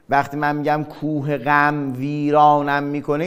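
ordinary talk, nothing marked

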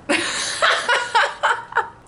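high burst of laughter; giggling fit. Real, not acted. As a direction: crack up laughing. Laughing at marriage question.

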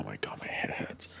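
whispered, pained aside. Real, not acted. Gamer whispers about a headache mid-game. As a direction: breathe it under your voice.

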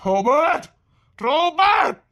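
calling out. Acted. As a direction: shout the name across the room. Calling out to someone.

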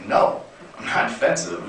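meek whispered denial. Retold or imitated. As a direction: barely dare to say it. Denying during argument with girlfriend.

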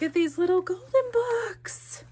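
climbing into a high squeal; delighted squeal. Real, not acted. Vlogger finds golden books in gift box, delighted squeal.

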